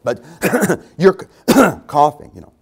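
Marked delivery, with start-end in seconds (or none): 1.0-2.3 hammed-up coughs, louder; mock coughing. Pastor mimics a sick churchgoer, coughing loud. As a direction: cough through the words like a sick man.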